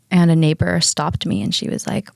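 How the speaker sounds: ordinary talk, nothing marked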